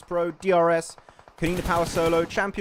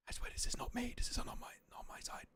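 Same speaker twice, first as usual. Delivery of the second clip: breathy whisper, no voice; 9 semitones higher, 15 dB quieter, faster than usual. Real, not acted. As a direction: whisper it off mic. Caster whispers during the feed freeze.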